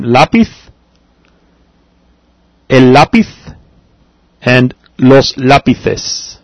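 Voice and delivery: lápiz and lápices with a th sound, slow; Castilian lisp. Shown as a demonstration. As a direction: put the tongue between the teeth for lápices. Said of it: "tongue between your teeth".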